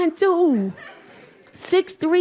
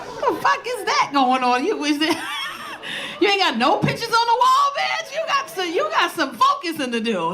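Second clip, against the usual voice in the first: high mock scolding; 7 semitones higher than usual. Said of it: "I be like".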